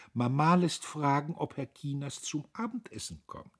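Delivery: high, easy-flowing invitation; glib. A narrator's reading. Sister relays mother's dinner invitation, glib and high. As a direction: pass on the invitation fluently, pitched high. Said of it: "sagte sie geläufig".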